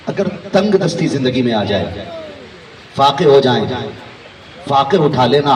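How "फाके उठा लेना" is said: ordinary talk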